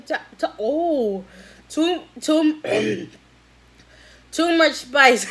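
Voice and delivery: hoarse cracking voice; losing voice. Real, not acted. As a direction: voice breaking from spice. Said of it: "Losing my voice".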